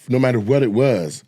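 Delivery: ordinary talk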